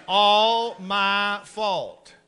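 "It's all my fault" stretched very slowly; slow drawn-out confession. Shown as a demonstration. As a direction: drill it slowly like a lesson. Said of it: "Shut up and repeat after me".

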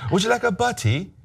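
high, lilting mock British accent; imitated British flight attendant. Flight attendant offering a passenger a sandwich, mock British accent. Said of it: "And then she goes".

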